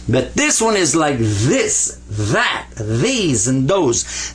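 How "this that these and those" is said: buzzing TH opening "this, that, these, those"; heavy TH. Shown as a demonstration. Teacher gives examples of the new letter, heavy TH on "this, that, these, those". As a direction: lean on each TH in "this, that, these, those". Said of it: "a real heavy T H sound".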